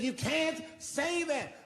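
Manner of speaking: high, slow whiny mock woman; hammed-up imitation of a tearful heckler; drawn-out high whimper of a protest; mock crying woman. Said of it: "She says".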